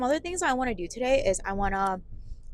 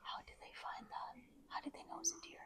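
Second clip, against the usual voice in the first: whispering; 4 semitones higher, 19 dB quieter than usual. Real, not acted. Whispering in a cave.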